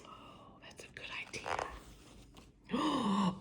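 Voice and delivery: soft whispered aside. Real, not acted. Reader pointing out the sleeping bear, whispered. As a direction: whisper so the bear won't wake.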